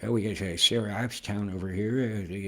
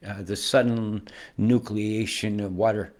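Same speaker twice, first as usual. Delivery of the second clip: normal talking voice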